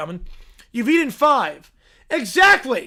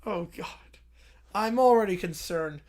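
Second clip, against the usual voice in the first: ordinary talk, nothing marked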